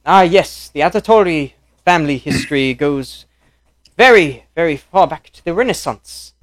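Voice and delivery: loud, high hammed-up Italian gentleman; Italian accent. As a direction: boom it out in mock Italian. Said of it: "to do Italian and British".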